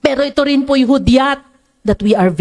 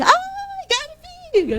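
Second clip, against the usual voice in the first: high squealing cry of a starstruck fan; 6 dB quieter than usual. Retold or imitated. Preacher imitating a concert fan screaming for [name]. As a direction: squeal the name like a fan seeing her idol. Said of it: "At sisigaw ka".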